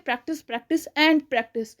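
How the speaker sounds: "Practice, practice and practice" very slow, each word weighted; repeated for emphasis. Shown as a demonstration. Teacher drilling the repetition tip with slow emphasis. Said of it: "practice makes perfect".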